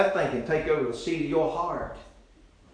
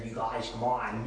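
soft, put-on voice of a gawky boy; quiet mock impression of a dorky boyfriend; mocking boy's voice; 3 semitones lower, 8 dB quieter than usual. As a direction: mimic the boy who can't talk right. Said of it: "He talks like this right here".

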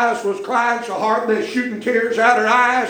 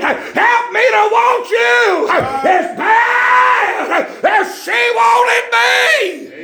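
much higher, very slow, loud cry; pleading prayer; 4 dB louder, much slower than usual. Acted. Preacher praying to God before the congregation, crying out. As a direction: stretch every word out, begging God.

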